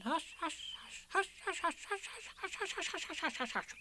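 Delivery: high, soft whispered hushes; hasty hushing. A narrator's reading. Rabbit's friends hushing each other down the line, whispered. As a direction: pass the hush quickly down the line. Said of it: "They all said hastily".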